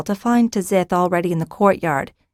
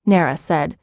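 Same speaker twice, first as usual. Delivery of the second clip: usual speaking voice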